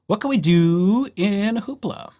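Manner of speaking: usual speaking voice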